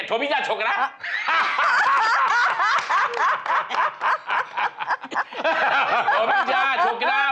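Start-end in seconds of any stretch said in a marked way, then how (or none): 0.9-6.1 very high long laugh; helpless long laugh at the joke; roaring laughter. Real, not acted. Audience roars at punchline. 6.2-7.3 slow, higher, half laughing; laughing through it. Retold or imitated. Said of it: "તમે અટકાવતે કે".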